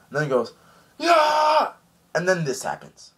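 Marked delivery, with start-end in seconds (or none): 1.0-1.7 very high, loud drawn-out battle cry; attack yell. Retold or imitated. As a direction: let out an imitation charging scream.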